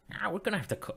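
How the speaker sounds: high-pitched attempt at an Irish accent; bad Irish accent. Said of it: "not a very good Irish accent".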